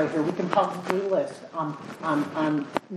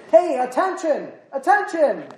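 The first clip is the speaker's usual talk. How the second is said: high, loud calling for attention; 7 semitones higher, 4 dB louder, slower than usual. Acted. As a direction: summon the crowd to listen. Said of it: "That means listen up, shouting".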